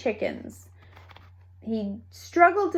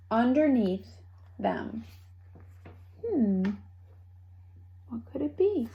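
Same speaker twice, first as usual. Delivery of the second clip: ordinary delivery, nothing marked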